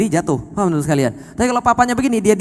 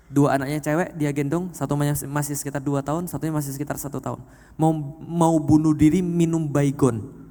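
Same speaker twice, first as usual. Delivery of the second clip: low, hushed sermon narration; grave storytelling; 4 semitones lower, 4 dB quieter than usual. Real, not acted. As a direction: drop low and soft for the grim account. Preacher recounts a grave story.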